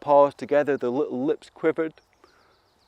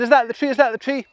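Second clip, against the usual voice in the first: excited asking; 9 semitones higher, 4 dB louder, faster than usual. Acted. Animals searching, calling out.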